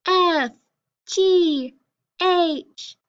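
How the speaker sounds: high-pitched letter names. Shown as a demonstration. Teacher reciting consonants for pupils, high voice. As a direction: chant each consonant high, sliding down. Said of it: "in a high voice".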